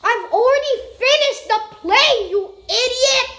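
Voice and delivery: loud, exasperated yell; angry yell. Real, not acted. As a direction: shout it in frustration. Yelling at friend.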